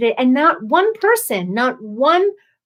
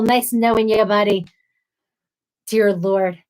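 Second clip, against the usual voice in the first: ordinary delivery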